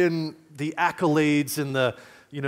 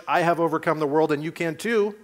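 ordinary delivery

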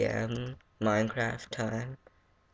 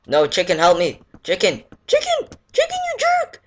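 much higher, louder frantic squeal; high-pitched squeal; 9 semitones higher, 9 dB louder than usual. Real, not acted. Squealing at the chicken.

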